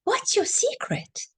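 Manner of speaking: put-on high, wondering actor asking the old man; amazed actor. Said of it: "he said".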